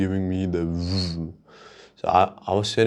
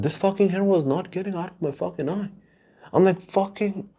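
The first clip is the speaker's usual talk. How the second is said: exasperated; 10 semitones higher than usual. Retold or imitated. Exasperated by hair in his eye.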